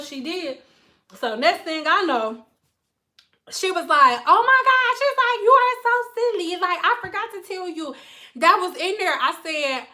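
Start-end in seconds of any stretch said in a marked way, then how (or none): 4.3-9.2 high giggly client. Retold or imitated.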